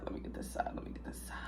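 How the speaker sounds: whispered, fading away. Real, not acted. To herself while eating, a fading whisper. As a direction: let it trail off into a whisper.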